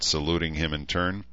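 ordinary delivery, nothing marked

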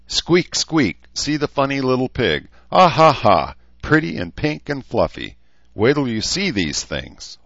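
high, loud sing-song sarcasm; acidly mocking. A narrator's reading. Rachel ridiculing notions of cute pigs, acidly mocking. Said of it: "Rachel said acidly".

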